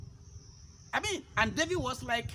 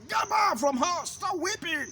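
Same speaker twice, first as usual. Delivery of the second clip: Abner shouting; 6 semitones higher than usual. Acted. Abner shouts at the weeping husband to stop, angry shout. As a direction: bark the orders at the weeping man. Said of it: "until Abner shouted".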